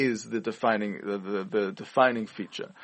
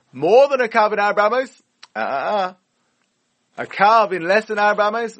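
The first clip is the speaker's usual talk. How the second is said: incredulous; 10 semitones higher, 9 dB louder than usual. Acted. Rhetorical challenge to the class.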